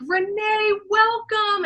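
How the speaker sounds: high, singsong greeting; warm welcome. Retold or imitated. Film team greeting the new actress, warm and welcoming. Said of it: "as genuine and warm as him".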